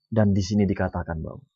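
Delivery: ordinary talk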